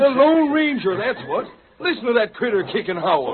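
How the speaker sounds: thrilled outlaw cry; excited reveal. Acted. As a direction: announce the big discovery. Outlaw names the horse's owner.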